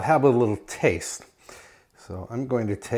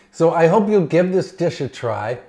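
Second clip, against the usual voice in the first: higher, louder eager plea; enthusiastic; 7 semitones higher, 6 dB louder than usual. Real, not acted. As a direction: really sell the invitation. Repeats the recommendation with more emphasis.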